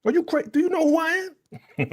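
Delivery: mock outrage. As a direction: squeal it like an offended boss. Mock outraged retold boss.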